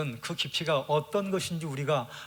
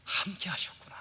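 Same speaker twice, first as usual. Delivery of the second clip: hushed, whispered realization; awed whisper; 4 semitones higher, 6 dB quieter than usual. Acted. Job whispering his awe that God stayed with him. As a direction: let the wonder fall to a whisper.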